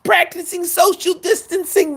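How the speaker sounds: very high put-on busybody voice; mock-fretful squeal, strained way up high; mimicked covid nag. People nagging him about vaccines and distancing, mimicked in a high squeal.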